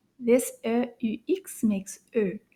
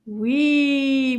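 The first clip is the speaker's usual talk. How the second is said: ordinary delivery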